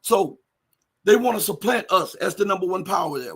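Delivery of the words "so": normal talking voice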